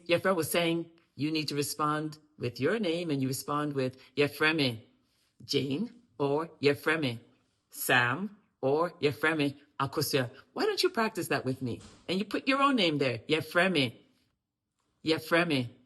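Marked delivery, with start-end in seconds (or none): none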